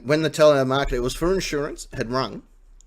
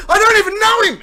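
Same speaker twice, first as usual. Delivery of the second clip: high, loud laughing yell; panicked outburst; 8 dB louder than usual. Retold or imitated. Retold telemarketer's panicked outburst.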